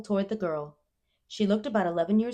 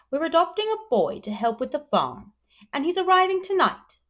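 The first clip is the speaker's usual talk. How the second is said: Marilla; 11 semitones higher, 8 dB louder than usual. A narrator's reading. Marilla voiced.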